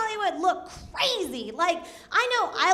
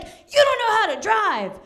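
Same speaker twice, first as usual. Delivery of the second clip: mock angry; 4 semitones higher, 4 dB louder than usual. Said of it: "he's all angry".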